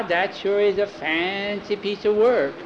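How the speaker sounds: put-on high, slow little-boy echo; child parroting an adult. A narrator's reading. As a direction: stretch it out, copying the grown-up. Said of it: "They're repeating everything we say".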